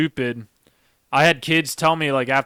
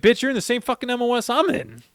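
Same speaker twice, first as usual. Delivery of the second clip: high, heated mock rebuke; retold heated comeback to a taunting Marine; indignant rant; 7 semitones higher than usual. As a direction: throw the insult back, pitched high.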